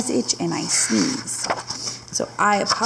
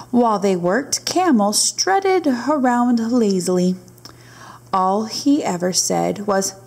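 lively storybook narration; reading to children. A narrator's reading. Teacher reading a camel story to her class, lively storybook read. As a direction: read it aloud to the little ones. Reads picture book aloud.

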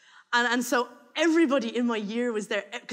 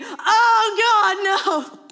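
high laughing groan; comic despair; 9 semitones higher, 9 dB louder, much slower than usual. Real, not acted. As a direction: laugh through the horror. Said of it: "laugh cry".

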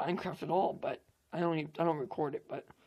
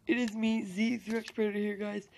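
excited intro; 4 semitones higher, 5 dB louder than usual. Acted. YouTube intro greeting.

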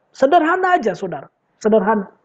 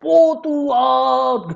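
very slow, drawn-out hammed-up cry to God; mock showy prayer; much slower than usual. Preacher mocking Pharisee show-off prayer, drawn-out mock wail. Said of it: "sambil berdoa pun dia teriak-teriak".

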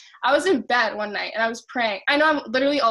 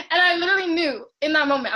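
tearful, high and louder; choked up; 5 semitones higher, 6 dB louder, faster than usual. Real, not acted. Telling her live audience, tearing up for real. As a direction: voice catching with real emotion. Recalling the moment God answered.

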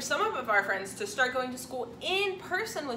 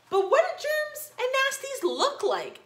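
high, curious princess voice; cartoon princess; 12 semitones higher, 3 dB louder than usual. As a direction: ask it like a little girl. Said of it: "asked the little princess".

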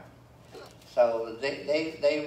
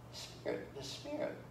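hushed spirit; 8 dB quieter than usual. Real, not acted. Whispered then high.

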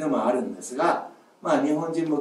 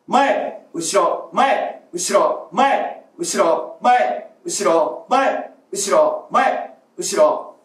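short loud shouted calls, higher on 前; loud drill calls; 3 semitones higher, 4 dB louder, much slower than usual. Shown as a demonstration. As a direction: call out each word short and loud, like a warm-up drill. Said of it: "今やってみますね". (大きな声で短く)

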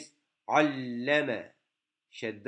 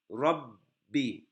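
usual speaking voice

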